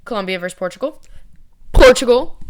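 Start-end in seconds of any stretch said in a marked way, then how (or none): 1.7-2.4 excited. Real, not acted. Excited pick of favourite team.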